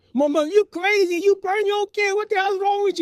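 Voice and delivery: high-pitched screaming tirade; outraged mother. Retold or imitated. Mother to father who burned his kids, outraged screaming. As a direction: imitate a mom shrieking in fury.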